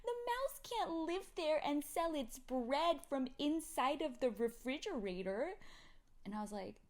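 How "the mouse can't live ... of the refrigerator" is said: put-on higher, louder, slow kid voice; an eager kid insisting; imitating a child. Mother imitating her other kid, eager toddler voice.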